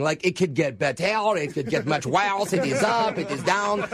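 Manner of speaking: cartoonish French accent, comically overdone; put-on French accent. Caller claiming French flu to pharmacist, cartoonish French accent. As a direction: slip into an exaggerated French accent. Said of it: "go in and out of a French accent".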